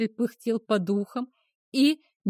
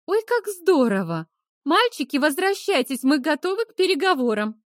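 high bright gushing call, louder; fake cheerful; 6 semitones higher, 4 dB louder than usual. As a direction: gush falsely to win them over.

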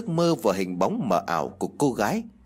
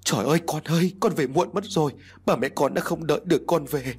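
higher-pitched, lightly acted wailing; bursting into tears; 5 semitones higher than usual. A narrator's reading. Village women greeting the bereaved son, mildly tearful. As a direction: lament to the son who came too late. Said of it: "đã bật khóc".

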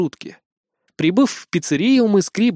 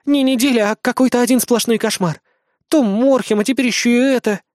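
put-on high girlish complaining; exasperated Azumi; 8 semitones higher, 4 dB louder than usual. A narrator's reading. Azumi grumbling about a nightmare week, exasperated. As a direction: lament in a high, fed-up voice.

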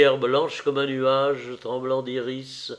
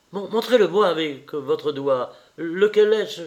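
louder, higher, insistent demand; urgent cry; 4 semitones higher, 6 dB louder than usual. A narrator's reading. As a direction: cry out urgently, building in pitch and force. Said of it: "s'écria-t-il". (cri pressant, de plus en plus fort)

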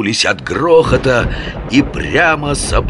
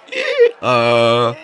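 donkey bray; 4 semitones higher than usual. A narrator's reading. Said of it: "выразительный ослиный рёв".

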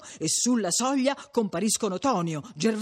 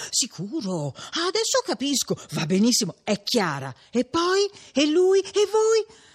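higher, eager show of listening; feigned attentiveness; 7 semitones higher than usual. A narrator's reading. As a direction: chime in to keep the chatterer going, pitch climbing. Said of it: "per far vedere che stava attenta".

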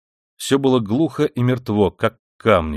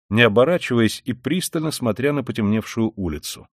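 ordinary delivery

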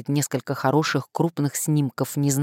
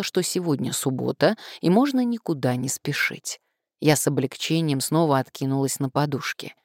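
usual speaking voice

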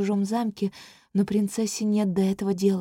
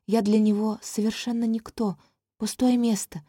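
ordinary delivery, nothing marked

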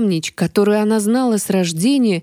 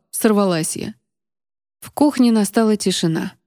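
ordinary delivery, nothing marked

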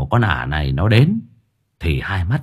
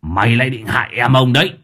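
gritted threat; 2 semitones higher, faster than usual. Acted. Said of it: "Lão nghiến răng ken két".

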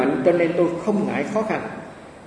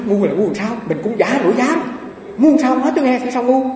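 louder, higher, offended comeback; indignant retort; 8 semitones higher, 4 dB louder than usual. Retold or imitated. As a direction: demand an explanation, half-laughing. Acted retort to master. (cãi lại thầy, giọng cao, to, bực bội)

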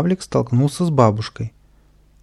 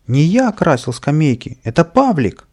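loud, higher blaming cry; protesting shout; 5 semitones higher, 5 dB louder than usual. Acted. Kapriska blaming Pavlik to the painter, shouted protest. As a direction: shout the blame onto someone else. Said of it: "закричал Каприска".

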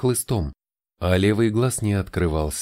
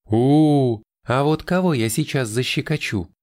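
игриво; 5 semitones higher than usual. A narrator's reading. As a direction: playfully pounce on a child. Тайра threatens to tickle playfully. (игривая угроза защекотать)

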